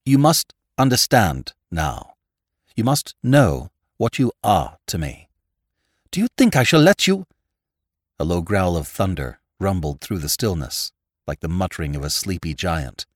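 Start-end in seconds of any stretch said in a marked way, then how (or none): 0.0-7.3 louder, higher, losing self-control; impassioned outcry. A narrator's reading. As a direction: forbid it in a passionate outburst. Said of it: "he cried".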